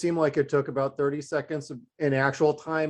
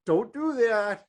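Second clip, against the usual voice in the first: laughing plea; 9 semitones higher, slower than usual. Retold or imitated. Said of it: "and I'm like".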